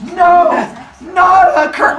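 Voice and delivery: high, loud whiny no; mock dismay. Cronus whining at his father's curse, high loud dismay. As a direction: wail in fake despair.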